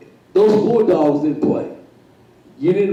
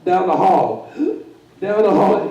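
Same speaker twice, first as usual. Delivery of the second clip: usual speaking voice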